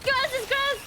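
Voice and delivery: high, loud playful threat; gleeful taunt shout. Real, not acted. Streamer chasing enemies, shouted taunt. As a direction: bellow it at the losers.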